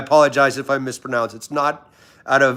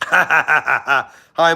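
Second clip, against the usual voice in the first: real laughing; 3 dB louder than usual. Real, not acted. Livestreamer amused by a viewer's comment, laughing. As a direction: burst of laughter into a greeting. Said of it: "You make me laugh".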